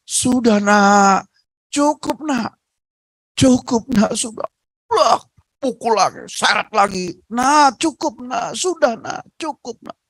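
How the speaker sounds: high, frantic begging cries; desperate pleading. Acted. Old mother begging her violent son to stop, desperate high pleading. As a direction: beg him to stop hitting you.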